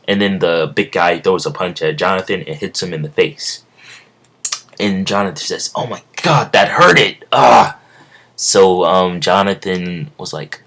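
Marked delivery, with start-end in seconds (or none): 5.7-7.8 pained cry. Acted. Jonathan punched in the face.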